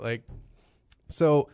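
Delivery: usual speaking voice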